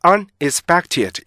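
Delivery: "unexpected" cut as UN, ex-PEC-ted; Korean learner's reading. Retold or imitated. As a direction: imitate a learner separating un from expected in "unexpected". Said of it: "우리나라 사람들".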